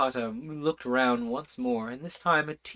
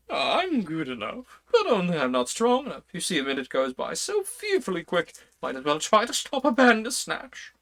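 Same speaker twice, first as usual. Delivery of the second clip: higher king's voice, a touch louder; the King explaining, a bit put upon; lightly voiced fussy King; 6 semitones higher, 3 dB louder than usual. King answers panting Alice.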